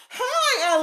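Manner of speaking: excited squeal. Real, not acted. Excited greeting to viewer.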